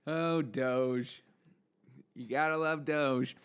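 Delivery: usual speaking voice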